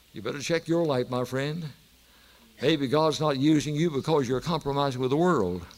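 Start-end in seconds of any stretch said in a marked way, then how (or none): none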